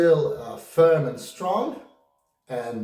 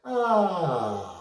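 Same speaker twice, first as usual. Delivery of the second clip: creaky fry sound; vocal fry; 3 semitones higher than usual. Shown as a demonstration. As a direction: rattle in the fry. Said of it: "this sound is there".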